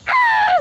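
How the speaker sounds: sudden high shriek, loud; retold scream. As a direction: shriek as the spider lands on your face. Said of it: "So I, like, screamed".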